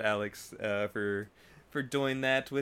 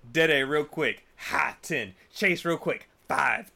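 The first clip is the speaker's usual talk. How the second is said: ordinary talk, nothing marked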